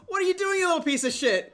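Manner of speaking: loud, high-pitched angry yell; outraged dad. Acted. Dad catches his son in the joke, outraged yell. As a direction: explode at the kid, voice jumping up.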